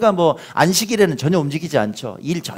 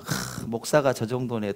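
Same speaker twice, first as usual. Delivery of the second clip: scoffing remark; 3 semitones lower, 11 dB quieter than usual. Retold or imitated. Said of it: "이렇게 생각하시면 안 돼요".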